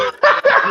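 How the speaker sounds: bursting out laughing loudly; a big helpless burst of laughter. Real, not acted. Co-host cracks up at story.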